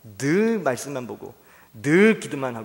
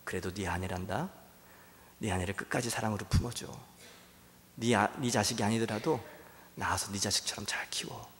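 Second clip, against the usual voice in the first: God's gentle voice; 5 semitones lower, 8 dB quieter than usual. Acted. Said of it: "주님이 다시 말씀을 하시는 거죠".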